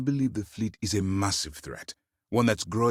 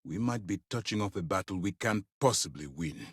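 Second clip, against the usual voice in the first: ordinary talk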